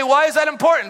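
high, loud challenging question; mock shout. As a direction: shout it as a heckler from the crowd. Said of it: "You say".

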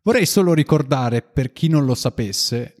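ordinary talk

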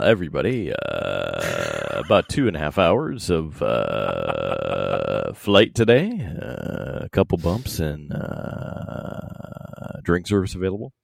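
lightly voiced airline pilot PA; calm pilot cabin announcement. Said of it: "a news voice?".

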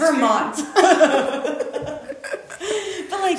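high squealing laugh. Real, not acted. Laughing burst.

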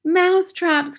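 high witch chorus echo; excited cry. A narrator's reading. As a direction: squeal it back to the leader. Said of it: "cried the witches".

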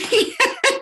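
sudden high burst of laughter; a real, happy laugh of disbelief; delighted laugh. Real, not acted. Laughs at being recognised.

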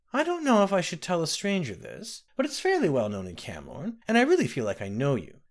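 a hint of a kindly old woman; old nursemaid. Ramke voiced old nursemaid.

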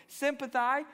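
sympathai said slowly with a proud rising end, Sympathai; showy Greek pronunciation. Shown as a demonstration. Pastor showing off Greek to the congregation: Sympathai with a proud rising finish. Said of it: "I thought I'd sound smart".

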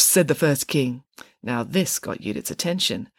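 ordinary delivery, nothing marked

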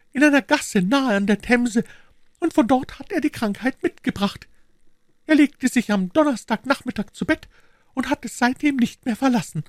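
put-on high, worried old housekeeper voice; fretting landlady pleading urgently; anxious housekeeper. Housekeeper explains the illness.

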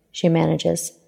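ordinary delivery

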